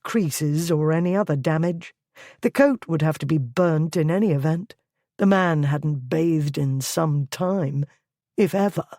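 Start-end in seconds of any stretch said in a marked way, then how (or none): none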